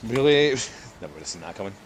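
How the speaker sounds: ordinary delivery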